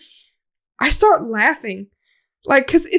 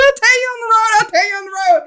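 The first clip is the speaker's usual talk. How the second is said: high, hurried-sounding re-enactment; urgent reply; 9 semitones higher, faster than usual. Retold or imitated. Fleeing to the car, urgent high reply to friend. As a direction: put off the question in a frantic high voice.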